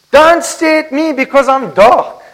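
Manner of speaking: insecure bride. Acted. Woman in the Song defending her dark skin, mildly pleading. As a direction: beg them not to look, a touch hurt. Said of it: "And she blames the sun".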